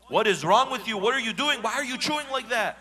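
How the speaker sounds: loud, high furious yell at the spouse; angry outburst. Acted. Angry spouse yelling about chewing. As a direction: snap at her chewing, full volume.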